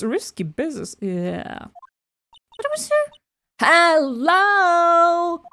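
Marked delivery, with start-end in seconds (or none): 2.6-5.4 high character voice. Acted. Trying character voice.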